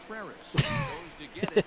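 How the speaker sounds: high, wheezy giggle; helpless amused giggles; cracking up. Real, not acted. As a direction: burst out laughing at your punchline. Laughing at the punchline.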